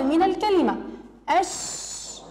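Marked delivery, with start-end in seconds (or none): 1.4-2.2 whispered الش- with no voice; isolated onset. Shown as a demonstration. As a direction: breathe out الش- alone, unvoiced and soft. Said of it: "تتصل اللام بالحرف الأول".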